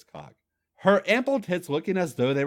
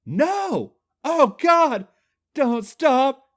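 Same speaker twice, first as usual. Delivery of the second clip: very high, loud moaning; 13 semitones higher, 8 dB louder, much slower than usual. Acted. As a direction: cry out in ecstasy. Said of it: "he moaned".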